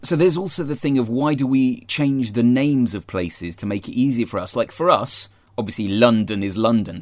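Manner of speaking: normal talking voice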